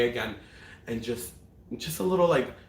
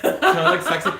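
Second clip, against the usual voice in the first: playful excited; 10 dB louder than usual. Real, not acted. Guest shows off outfit.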